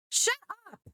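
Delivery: ordinary delivery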